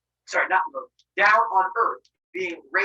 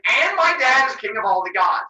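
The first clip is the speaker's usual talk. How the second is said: loud, raised-pitch disbelief; incredulous outburst; 4 semitones higher, 7 dB louder than usual. Acted. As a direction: repeat it back loudly, appalled. Zeus incredulous about father's rank.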